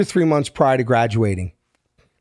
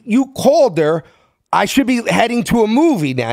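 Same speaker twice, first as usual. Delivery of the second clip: worked-up phone call; 6 semitones higher than usual. Retold or imitated. Excited phone call with Bert.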